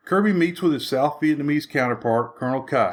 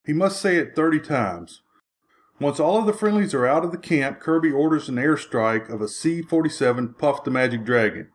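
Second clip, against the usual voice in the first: normal talking voice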